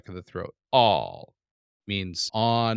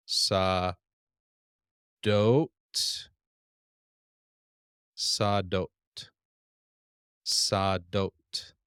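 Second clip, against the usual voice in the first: sadot said as down then back up; sadot, sadot, sadot slow and sung; reading tune; slower than usual. Shown as a demonstration. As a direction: read sadot going down and back up. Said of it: "we come down, back up".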